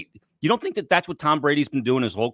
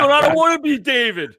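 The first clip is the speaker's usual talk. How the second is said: laughing mock whine; 12 semitones higher than usual. Jokingly voicing scared Jeff.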